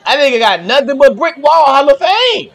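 loud, high excited exclamation; real courtside-style outburst; excited reaction. Real, not acted. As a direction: hype up the play at full voice. Live reaction to big defensive play.

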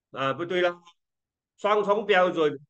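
ordinary talk, nothing marked

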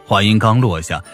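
ordinary delivery

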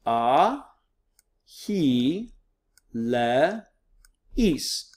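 "A, he, le, is" in separate syllables, long pauses between; "A", "he", "le", "is", each isolated for learners. Shown as a demonstration. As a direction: sound out "A, he, le, is" one piece at a time. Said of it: "broken them up into syllables".

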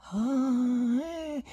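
an uneasy, wincing ah; grimacing ah. A narrator's reading. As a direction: wince at the name. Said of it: "fece l'ospite con una smorfia".

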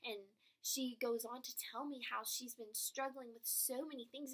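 ordinary talk, nothing marked

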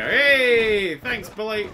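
loud high call; excited shout. Real, not acted. Player greeting a helpful bull, excited shout. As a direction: call out happily.

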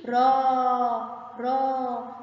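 "را" with the aa held long; long vowel. Shown as a demonstration. Teacher sounding out a word for pupils, "را" held long. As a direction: draw out the vowel in "را". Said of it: "الراء صوت طويل".

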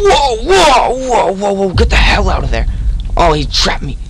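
high panicked yelling; panicked shout. Real, not acted. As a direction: scream in panic while fleeing. Panic chased by monster.

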